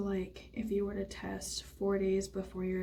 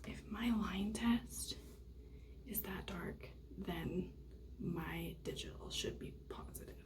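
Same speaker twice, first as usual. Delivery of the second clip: hushed whisper over the result; 9 dB quieter than usual. Real, not acted. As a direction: whisper so nobody hears.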